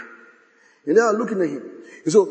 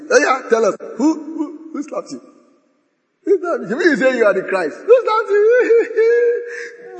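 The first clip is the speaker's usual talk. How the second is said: high, loud jeering taunts; mocking taunt; 10 semitones higher, 4 dB louder than usual. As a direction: taunt him like a cruel soldier. Soldiers mocking blindfolded Jesus.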